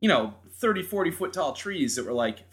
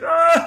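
high, loud retold scream; dad screaming while running from the falling tree; panicked scream; 14 semitones higher, 10 dB louder than usual. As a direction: yelp as you run for the garage. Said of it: "He's like".

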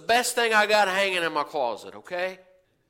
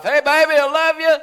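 higher, louder mock call across the room; calling out to wife; 9 semitones higher, 9 dB louder, faster than usual. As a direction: call out sweetly and loudly to her. Husband calling to his wife.

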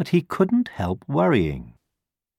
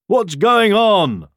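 calling out; 9 semitones higher, 4 dB louder than usual. A narrator's reading. Buffaloes to the stampeding animals, calling out. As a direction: call across to them, pitched up. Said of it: "they called".

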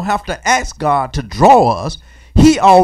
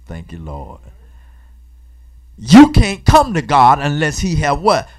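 ordinary talk

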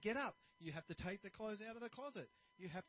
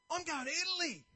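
high cheerful announcing; happy customer telling the barber, higher and cheerful; happy traveler; 10 semitones higher, 8 dB louder than usual. Retold or imitated. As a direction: beam about the trip. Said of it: "he's really happy".

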